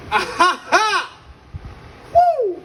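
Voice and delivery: mocking cackle. Sister laughs at his shirt, mocking cackle and whoop. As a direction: cackle at someone, then a very high woo.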